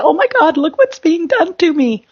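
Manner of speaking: high, whining oh my God; mock victim wail. Host mocking her listeners' victim cry, high whine. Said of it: "we've got to look out and say".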